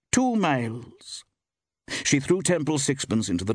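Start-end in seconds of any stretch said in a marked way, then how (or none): 0.1-1.2 high, very slow intoning; intoned. A narrator's reading. Woman shopkeeper repeats the distance, intoning slowly. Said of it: "intoned the woman".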